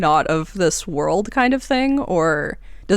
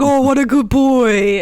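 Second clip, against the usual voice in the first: put-on high doting baby-talk praise; doting pet talk; 6 semitones higher, 4 dB louder than usual. Patrons cooing at the fox, doting.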